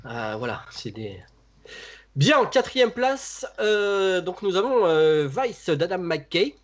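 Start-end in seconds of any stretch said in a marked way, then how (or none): 2.1-6.5 slow, higher host announcement of the fourth place; drawn-out hosting voice; countdown announcer. Acted. Announcing the ranking.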